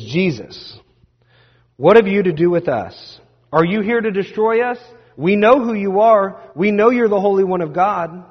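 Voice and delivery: loud, high, hollering challenge; hollering demon. Retold or imitated. Possessed man hollering at Jesus in the synagogue, loud and high. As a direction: yell at him as if cornered. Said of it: "He starts hollering".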